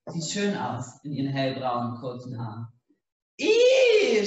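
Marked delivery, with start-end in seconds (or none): 3.4-4.2 high, loud disgusted shriek; shrill squeal. A narrator's reading. Donna shrieking with egg yolk in her hair, high and loud. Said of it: "schreit Donna und weint".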